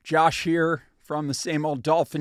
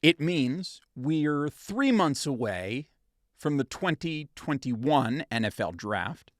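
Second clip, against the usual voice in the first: usual speaking voice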